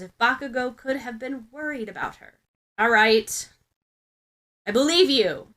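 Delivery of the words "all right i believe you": snapped. Acted. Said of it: "Bakugo snaps this".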